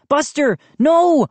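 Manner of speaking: loud, high call to the dog; boy shouting at his dog to stop. A narrator's reading. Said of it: "I shouted".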